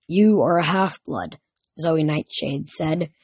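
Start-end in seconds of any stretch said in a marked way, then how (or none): none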